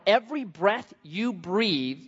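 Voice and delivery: usual speaking voice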